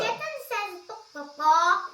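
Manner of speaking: high playful bok bok; chicken clucking. Shown as a demonstration. Chicken noise game with toddler.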